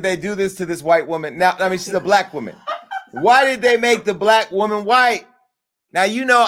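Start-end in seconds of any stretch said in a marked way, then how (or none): none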